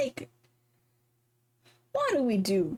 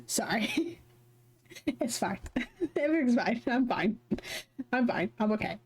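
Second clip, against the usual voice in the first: giggly reassurance; 4 semitones higher than usual. Real, not acted. Laughing fit, insisting she's fine.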